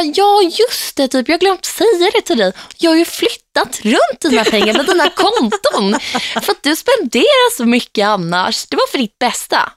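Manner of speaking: high, slow, breezy mock mum; airy sing-song mum voice, unbothered; imitating mum. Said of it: "Hon bara". (hög, långsam, oberörd härmad mamma)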